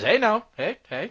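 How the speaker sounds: high, loud protest in character; alarmed constable. Acted. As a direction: cut in loudly as the wary constable. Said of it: "He says".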